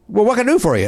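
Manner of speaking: a hint of the famous announcer on the phone; Skip Carey. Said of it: "legendary voice".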